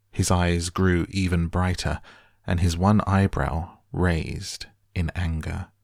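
ordinary delivery

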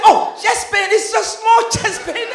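hammed-up shock; alarmed laughing disbelief. Patient reacts in alarm to the diagnosis, high and laughing.

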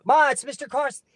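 put-on high, loud kid's shout to mother; boy calling mom. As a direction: call across the house as a small boy. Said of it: "I'm a little fucking four-foot kid".

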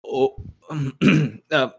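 ordinary delivery

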